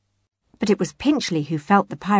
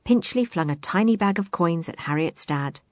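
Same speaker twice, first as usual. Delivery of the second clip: ordinary delivery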